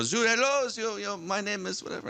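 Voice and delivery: a playful imitation of a French stripper's accent. Teller imitates stripper's greeting, in a mock French accent. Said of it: "it was sort of a French accent".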